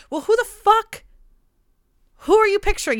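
high, loud, outraged who the fuck; mock outraged retort. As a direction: call him out, half-mocking. Retort she'd give the guy.

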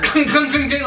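high, rattling clinks; imitated armour clanking. Game master shows plate mail landing, imitated armour clanking. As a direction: make the clatter of falling plate mail. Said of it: "clanging of plate mail".

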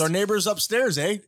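lightly put-on Canadian accent with a tag eh; mock Canadian accent. Canadian accent to the brother, teasing about neighbors upstairs. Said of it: "still doing the Canadian accent".

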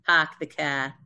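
Boston "park the car", "park" with an open "ah" and no R; broad Boston "ah" and no R in "car"; Boston accent. Shown as a demonstration. Demonstrating the Boston "ah" to the group, in a Boston accent. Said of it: "in Boston, you're saying ah".

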